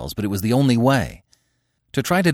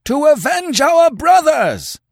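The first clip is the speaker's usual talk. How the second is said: slow, very high, loud war cry; howled; 7 dB louder, slower than usual. Acted. Warriors answer Conan's challenge, howling. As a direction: howl for vengeance as a mob. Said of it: "they howled".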